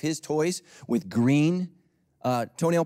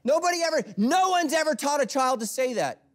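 loud; 14 semitones higher, 5 dB louder than usual. Real, not acted. Preacher carrying shout on.

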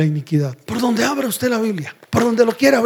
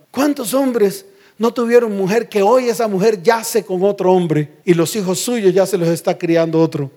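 normal talking voice

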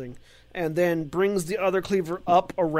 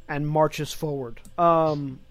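ordinary talk, nothing marked

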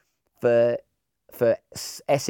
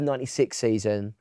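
ordinary delivery

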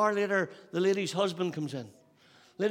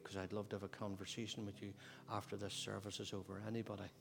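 soft, low, gentle appeal; altar call; 7 semitones lower, 11 dB quieter than usual. Acted. Pastor inviting the unsaved to respond, hushed altar call. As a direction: invite them quietly to come forward.

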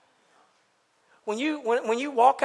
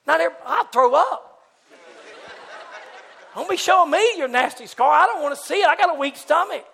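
raised, loud squeamish protest; mock disgust; 6 semitones higher, 6 dB louder than usual. Weak-stomached man refuses to see a scar, loud mock disgust. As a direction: recoil loudly, as if about to be sick. Acts being shown a nasty scar.

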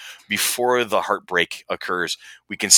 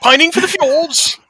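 high silly voice; 6 dB louder than usual. Pretend. Monty Python parrot quote.

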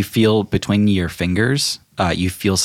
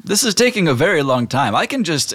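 genie; 9 semitones higher than usual. Acted. Genie impatient.

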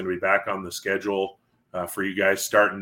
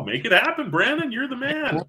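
cheering a co-host's idea, excited and much higher; hype cheer; 14 semitones higher than usual. Real, not acted. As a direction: pump him up like a fan. Hyping up co-host's award idea.

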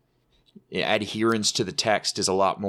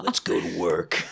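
action hero line; 5 semitones lower than usual. Pretend. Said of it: "he's just like".

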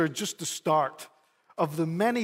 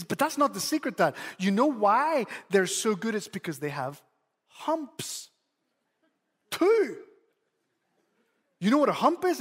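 put-on higher, eager little boy; excited child secret; 3 semitones higher than usual. Son teasing out the secret to dad, high eager child voice. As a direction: voice the son building up his secret. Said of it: "But he says".

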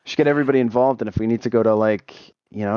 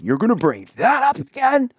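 high, slow exasperated retort; high-pitched indignant whine; 11 semitones higher, slower than usual. Retold or imitated. Retelling his own exasperation.

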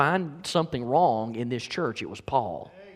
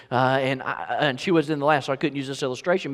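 usual speaking voice

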